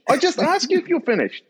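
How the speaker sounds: very high, exasperated retort; mock anger at peak frustration; angry and angrier. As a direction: imitate an instructor losing it. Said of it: "the staff's getting angry and angrier".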